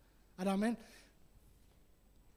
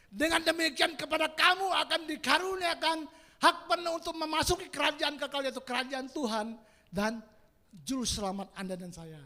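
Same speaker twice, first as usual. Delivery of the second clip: loud, raised proclaiming voice; declaimed scripture; 5 semitones higher, 8 dB louder than usual. Real, not acted. Preacher reading the closing verse to congregation, loud proclamation. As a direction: proclaim the promise to the whole congregation.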